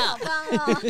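giggling through the words; happy giggle at the end; laughing. Acted. Laughing with friends. (笑着说)

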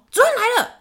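high, loud shout of warning; retold shout. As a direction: yell it like a prankster raising the alarm. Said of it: "我朋友就是大叫说".

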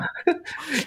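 short, high-pitched laugh; a brief high giggle amid banter; real laugh. Real, not acted. (短促的高音笑声)

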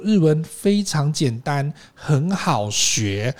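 very slow, measured encouragement; 老师慢慢安慰学生; slow teacher reassurance. Retold or imitated. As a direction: reassure beginners slowly like a teacher. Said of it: "日本老师哈".

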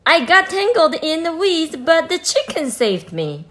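calling out. Acted. Rescued child calls out.

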